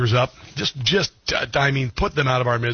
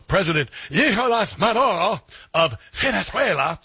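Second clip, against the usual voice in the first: light mock Spanish accent on the name, higher; 7 semitones higher than usual. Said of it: "I have to do that in a Spanish accent".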